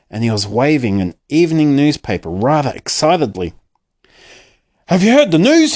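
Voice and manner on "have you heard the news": high, loud excited announcement; excited cry. A narrator's reading. Father bursting into the room with news, excitedly. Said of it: "he cried".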